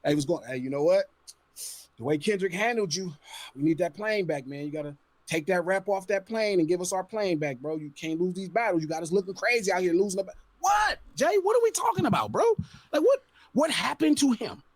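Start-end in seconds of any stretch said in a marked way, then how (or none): 0.0-10.3 much lower, mocking exec on the phone; a mocking record exec on a phone call; mock label phone call. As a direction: play the smug label boss calling to take the plane back. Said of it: "what does that phone call sound like".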